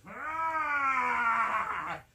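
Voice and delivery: loud long monster roar; loud drawn-out monster roar; gorilla roar. Acted. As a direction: roar like a huge beast. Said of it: "Green gorilla suddenly goes".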